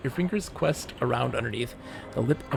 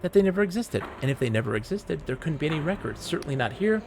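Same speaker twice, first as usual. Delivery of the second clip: higher, prim librarian voice; fussy librarian, pitched up; old librarian voice; 4 semitones higher than usual. Acted.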